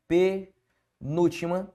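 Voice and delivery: "Penúltima sílaba" slowly, with a pause after Pe and before sílaba; "Penúltima sílaba" drawn out in pieces for the class; broken up slowly. Shown as a demonstration. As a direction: spell out "Penúltima sílaba" in separated chunks. Teacher repeats the term split up.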